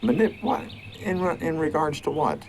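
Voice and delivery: high, loud incredulous questioning; real indignant disbelief. Real, not acted. Father disputes being called manipulator.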